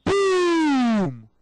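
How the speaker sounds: loud, high boom sliding down; explosion noise. Retold or imitated. Teller sets off a nuclear explosion, loud drawn-out boom. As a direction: shout a long explosion that falls in pitch.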